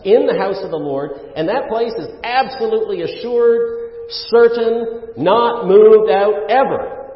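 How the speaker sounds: high, loud pulpit proclamation; emphatic preaching. Real, not acted. Preaches the assurance at full force.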